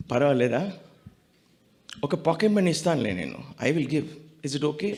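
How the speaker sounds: soft, gentle retold request; very sweet. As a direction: ask it humbly and softly, as the guru did. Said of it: "Very sweetly".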